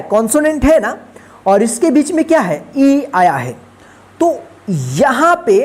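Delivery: usual speaking voice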